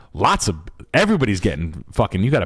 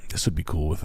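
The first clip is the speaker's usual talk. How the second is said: whisper; 6 semitones lower, 6 dB quieter, faster than usual. Real, not acted. Aside on house windows.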